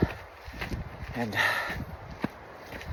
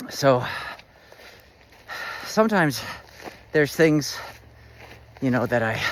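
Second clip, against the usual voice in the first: ordinary talk, nothing marked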